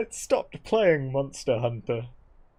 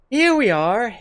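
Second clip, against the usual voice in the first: high, loud Bristolian grandma; West Country grandma; 12 semitones higher, 9 dB louder than usual. Shown as a demonstration. Player trying out a grandma voice, loud West Country welcome. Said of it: "sweet old country grandma".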